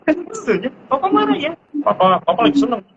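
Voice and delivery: put-on high childlike questioning voice; little child voice. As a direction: play a tiny child checking papa's mood. Child reading father's face.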